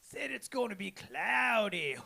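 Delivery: high, slow, strained drawl; Shorty after a hit. Retold or imitated. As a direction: squeeze it out as if holding in smoke. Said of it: "And he took a hit".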